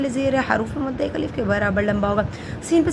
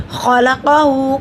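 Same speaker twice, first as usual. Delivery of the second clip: slow, louder recited word; recited demo word; 2 semitones higher, 6 dB louder, much slower than usual. Shown as a demonstration. Teacher models the word for students in slow chant. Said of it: "قاف دونوں تو والا موٹا پڑا جاتا ہے".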